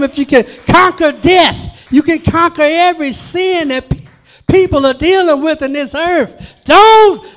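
Satan begging Jesus not to raise Lazarus, panicked; Satan pleading. Retold or imitated. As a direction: beg Jesus frantically, rising to a yell. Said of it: "I'm sure Satan was like".

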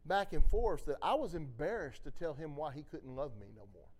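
ordinary talk

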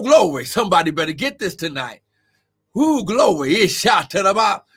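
ordinary talk, nothing marked

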